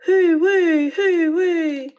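loud, high wind howl. A narrator's reading. Wind on the way back.

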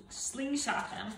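plain reading voice

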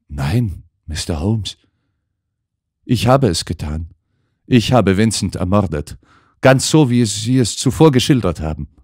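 lightly voiced young actress, rising to a pleading cry; an actress insisting on her guilt, growing desperate; actress confessing. A narrator's reading. (Schauspielerin gesteht, zunehmend verzweifelt)

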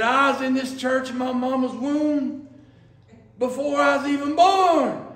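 a hint of an old man asserting his years; swelling pride in his long membership; proud declaration.

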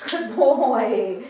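loud, drawn-out praise; excited praise. Real, not acted. Trainer to her dog after a successful shake, excited praise. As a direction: praise the dog with big delight.

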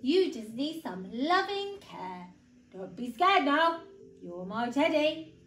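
reassuring storybook bear; big bear. A narrator's reading. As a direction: coax a frightened little one as a big bear. Bear comforts the little voice.

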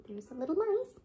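high, soft cat voice. Acted. Simpkin by the bed, wanting mice, in a high soft cat voice. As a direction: speak it as the hungry cat.

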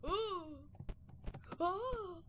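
real startled oh's; rising alarm. Real, not acted. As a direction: gasp as it goes wrong. Reacting in game.

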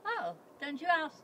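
ordinary talk

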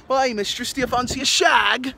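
high, loud mock English accent; stupid fake accent. Mocking a guy chatting up a drunk girl, stupid English accent. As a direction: put on a silly English come-on. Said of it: "put on some stupid fucking accent".

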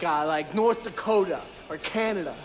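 ordinary talk, nothing marked

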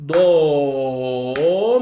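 "Do" held long, a beat and a half, low then rising; dotted-note do. Shown as a demonstration. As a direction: stretch "Do" well past one beat. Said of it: "bak noktalı yapıyorum".

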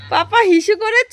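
high, loud, slow call across the house. Real, not acted. Daughter calling loudly to papa about the toilet.

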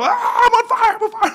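very high, loud comic shriek; hammed-up squeal of a kid in panic; mock panic. Boy with burning marshmallow on his thigh, mock panicked.

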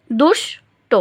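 "দুষ্ট" broken as দুষ, pause, টো; syllable by syllable. Shown as a demonstration. Teacher sounding out the example, "দুষ্ট" syllable by syllable. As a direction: split "দুষ্ট" into two separate beats. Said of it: "প্রথমে একবার উচ্চারণ করি".

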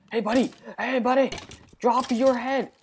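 loud, high-pitched calls to a mob; pestering shout at a game enemy; calling out. Real, not acted.

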